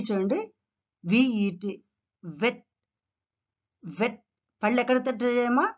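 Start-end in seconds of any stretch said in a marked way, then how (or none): 2.4-4.2 "వెట్" as vet, teeth on bottom lip; V sound. Shown as a demonstration. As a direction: start "వెట్" with V. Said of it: "bottom lip కు లోపల".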